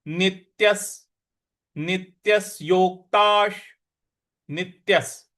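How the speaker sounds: नित्यस with the half त pressed firmly; stress on half t. Shown as a demonstration. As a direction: press the त in नित्यस, slow and clear. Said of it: "नी के बाद जो आधा त है उस पर भी हमको आघात देना है".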